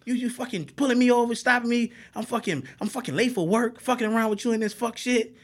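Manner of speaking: high-pitched, agitated mock rant; irate driver. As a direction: complain at the officer like you've been wronged. Acting out driver ranting at officer.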